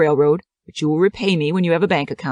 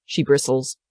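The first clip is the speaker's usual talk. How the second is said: usual speaking voice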